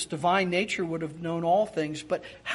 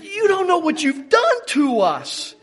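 high, loud, dramatic guilt trip; hammed-up wounded reproach; reproachful mother; 9 semitones higher, 6 dB louder than usual. Mary to her son, melodramatically reproachful. Mary's reproach, acted for laughs.

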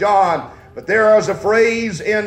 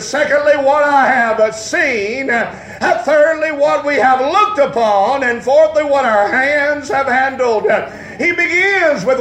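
fiery preaching; 4 semitones higher than usual. Acted. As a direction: preach it full force to the congregation. Preaching at full force.

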